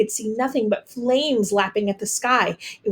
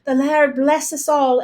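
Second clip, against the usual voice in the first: ordinary delivery, nothing marked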